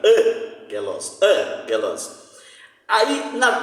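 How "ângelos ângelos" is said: Ângelos slowly, the weight on ÂN; slow stress on first syllable. Shown as a demonstration. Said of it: "acento está na proparoxítona". (Ângelos devagar, tônica no ÂN)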